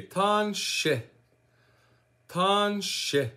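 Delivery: Tanshe said carefully, TAHN-SHEH, first syllable held; teaching pronunciation. Shown as a demonstration. Teacher showing viewers hello in Michif, Tanshe as careful TAHN-SHEH. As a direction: model "Tanshe" for learners, two drawn-out syllables. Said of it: "you say".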